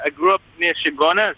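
hammed-up Trinidadian lilt; put-on Trinidadian accent. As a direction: fake a native Trinidadian accent. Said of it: "as kind of Jamaican stroke Trinidadian accents go".

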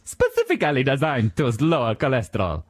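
mock-Italian slogan reading; Italian accent. Quoting the cereal box as the chef, Italian accent. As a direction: imitate the Italian chef's sales line. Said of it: "the way he says specifically".

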